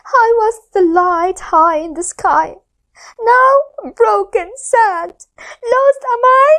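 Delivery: high, tearful moon voice; sniffly moon. Acted. The moon telling the fish she is lost, tearful. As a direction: sniff through the words. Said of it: "Luna sniffed".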